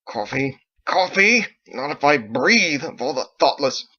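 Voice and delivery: loud disbelieving coffee, then slow and indignant; outraged. Acted. Son outraged by his father's coffee offer, loud and appalled.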